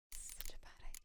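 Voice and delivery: worried whisper. Real, not acted. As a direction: whisper it nervously. Whispered worry.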